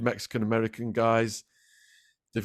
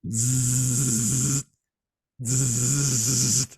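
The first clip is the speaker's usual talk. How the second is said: imitated buzz. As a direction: buzz it like a cattle prod zapping. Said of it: "I hear this noise".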